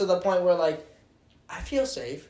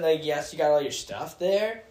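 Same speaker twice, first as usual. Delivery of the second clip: usual speaking voice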